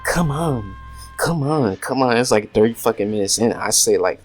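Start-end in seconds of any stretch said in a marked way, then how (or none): 0.0-2.2 high, drawn-out mock whine; whiny pleading, voice raised; whining. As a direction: imitate an impatient whine, pitched up. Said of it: "she started whining like".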